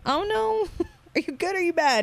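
high, laughing sugary voice asking a spirit; mock sweet coaxing. Asking the spirit if it's good or bad, high sweet voice. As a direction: coax the spirit like talking to a baby.

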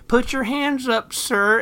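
slow, high-pitched agent's command; mock TSA agent. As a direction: draw out the order, loud and high. Voicing agent ordering frail man.

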